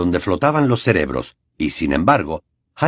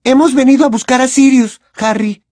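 high, louder and strained; shrill, tense; 5 dB louder than usual. A narrator's reading. Hermione reminding Harry of Sirius, shrill. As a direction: remind him, tight with tension. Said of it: "con voz chillona, cargada de tensión".

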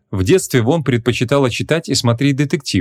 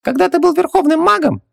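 very high squeaky little genie voice; squeaky genie servant, resigned; philosophical genie. A narrator's reading. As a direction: play a tiny high-pitched genie shrugging it off. Khubaksis voice continues.

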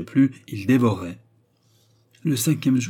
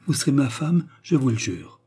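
higher, louder vow, easing at the end; ardent exclamation; 5 semitones higher, 4 dB louder than usual. A narrator's reading. Suitor swears to marry Mary, exclaiming. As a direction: declare it ardently, as an oath. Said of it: "s'écria James".

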